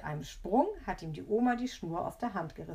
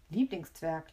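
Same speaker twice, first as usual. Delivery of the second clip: usual speaking voice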